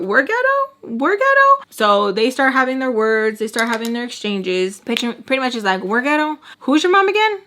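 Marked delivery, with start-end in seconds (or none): 0.0-1.6 high-pitched mock disbelief; much higher, incredulous comeback; indignant repeat. Retold indignant comeback to an insult. 5.8-7.4 high-pitched, provoking questions; imitating a taunting confrontation, pitched up; mouthy taunting. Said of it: "I was talking to her like that".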